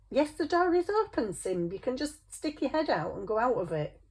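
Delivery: high-pitched, exasperated coaxing; exasperated pet talk. Real, not acted. Owner telling the pet the door is open, high and exasperated. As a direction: spell it out for a pet, pitched well up.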